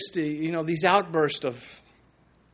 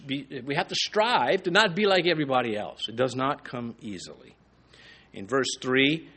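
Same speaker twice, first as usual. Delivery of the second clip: ordinary delivery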